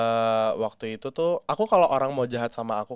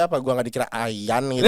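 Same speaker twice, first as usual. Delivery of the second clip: ordinary talk, nothing marked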